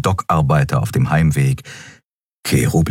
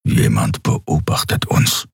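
much lower, slow half-whispered warning; Kero low and hushed; 7 semitones lower than usual. A narrator's reading. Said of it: "Kero senses watcher".

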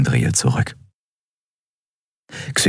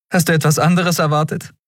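put-on higher, quick, impatient young captain; Endriel, brisk captain; 12 semitones higher, faster than usual. A narrator's reading. Captain Endriel urges the crew to move on, higher and quick. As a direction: hurry them along, half joking.

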